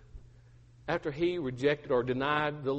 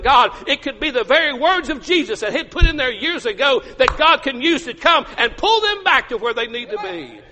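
high, loud, urgent sermon delivery; full-force preaching; 7 semitones higher, 7 dB louder than usual. Acted. Preacher urging parents about their children, full force. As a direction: press it on them with rising fervor.

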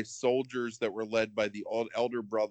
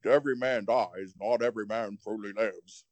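gruff British pirate accent, lightly done. Shown as a demonstration. On request, mock Nicolas Cage line in British pirate accent. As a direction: intone it like a British brigand king. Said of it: "I wanna hear this accent".

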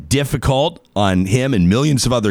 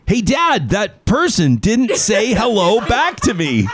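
put-on kid at the same volume; 10 semitones higher, slower than usual. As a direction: imitate the five-year-old telling Dad, up high and slow. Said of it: "like, in the same volume".

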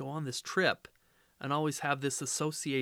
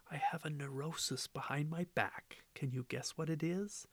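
soft whisper into an ear; 8 dB quieter than usual. Acted. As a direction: lean in and whisper it. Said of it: "whispers into my ear".

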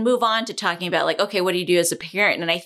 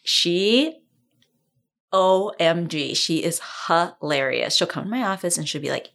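usual speaking voice

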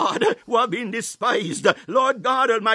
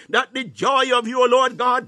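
ordinary talk

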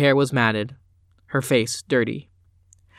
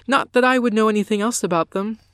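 innocent lie; 8 semitones higher, 3 dB louder than usual. A narrator's reading. Blatant lie to her father.

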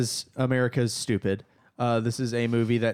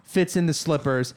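normal talking voice

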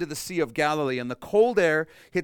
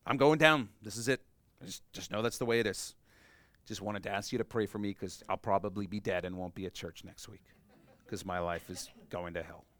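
softer, deflated doom-saying; gloomy resignation; 7 semitones lower, 6 dB quieter than usual. Acted. Worrier asking the church to pray, mock gloomy resignation. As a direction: mope it out like it's already over. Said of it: "It's "we are perishing".

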